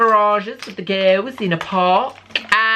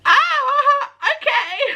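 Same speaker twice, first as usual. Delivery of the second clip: very high, mock-cheerful squeal; retold panicked reaction; 3 dB louder, much slower than usual. Student reacting to lecturer's email, imitated high squeal. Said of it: "And I was like".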